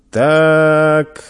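merchant's lingering acknowledgement; drawn out. A narrator's reading. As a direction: stretch it out while weighing things up. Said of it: "протянул торговец".

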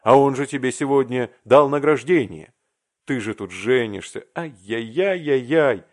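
loud, dismayed reproach; acted outburst of alarmed reproach; loud scolding. Arkady scolds his unfinished friend.

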